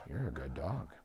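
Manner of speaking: soft, low, calm praise to the dog; quiet praise. Shown as a demonstration. Owner softly praising the dog who stopped jumping, hushed and calm. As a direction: murmur it gently so the dog stays calm. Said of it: "Reinforce very quietly".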